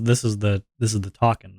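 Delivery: ordinary talk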